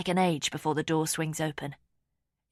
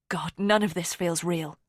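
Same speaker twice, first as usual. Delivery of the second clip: plain reading voice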